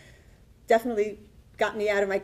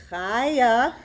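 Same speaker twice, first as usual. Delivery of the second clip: a hint of a curt, displeased caterer saying her name; louder, lightly voiced curt caterer; unpleasant tone; 3 semitones higher, 7 dB louder than usual. Caterer calling her on the phone, lightly imitated displeased tone. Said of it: "the tone was not a pleasant tone".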